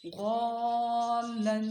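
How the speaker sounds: गौलन held very long; drawn-out word. Shown as a demonstration. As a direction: stretch गौलन slowly as one word. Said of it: "यूँ भी बताएं".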